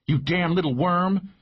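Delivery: high, angry woman's voice; a contained scream, pitched high without much volume; mild narrated scream. A narrator's reading. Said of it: "we heard Maggie scream at the top of her lungs".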